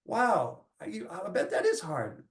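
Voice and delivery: a father calming his upset daughter, soft and slow; gentle empathy. Retold or imitated. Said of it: "I have to be like".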